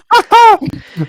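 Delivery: high, loud burst of laughter; sudden loud cackling laugh. Real, not acted. Player laughs at dragon talk.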